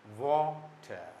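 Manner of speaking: "वाटर" very slow, ending without R; no final R. Shown as a demonstration. Teacher stretches "वाटर" slowly to drop the R. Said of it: "आर का उच्चारण नहीं होता है".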